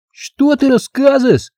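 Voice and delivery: put-on high, squeaky childish threats; lisping kid playing a violent husband; little boy carried away by his role. Boy playing husband threatens girl, high lisping child voice. Karapuz answers the girl's threat.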